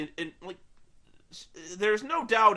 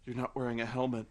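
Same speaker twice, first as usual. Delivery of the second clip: a hint of low ghostly nagging; lightly voiced ghost; 7 semitones lower, 5 dB quieter, faster than usual. Ghost of Edward scolding Bella, lightly low. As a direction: nag softly and low. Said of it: "He's like".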